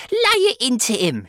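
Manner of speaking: high, loud gleeful egging-on; excited harpy's screech; shrieking. A narrator's reading. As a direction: shriek encouragement to the punisher. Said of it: "she was shrieking".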